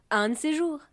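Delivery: loud, high send-off; cried farewell. A narrator's reading. Water drop leaving the others before diving, calling out. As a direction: call back to those left behind. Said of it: "cria la goutte d'eau".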